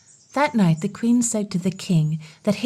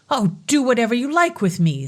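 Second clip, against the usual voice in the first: resigned defiance; 5 semitones higher than usual. Acted. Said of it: "said the king".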